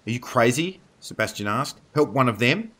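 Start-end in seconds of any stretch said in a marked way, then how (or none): 0.1-0.8 higher, rising disbelief; incredulous. A narrator's reading. Sebastian reacts to Dana wanting to help a wolf, incredulous. Incredulous question amid battle. 1.9-2.7 raised, high rising question; incredulous. A narrator's reading. Sebastian balks at helping one of the wolves, incredulous.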